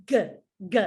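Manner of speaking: "g" as a short hard sound, as ends leg; short, separate "g" sounds, not the letter name; isolated G sound. Shown as a demonstration. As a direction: give just the hard "g" sound, twice. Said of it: "the last sound in leg is".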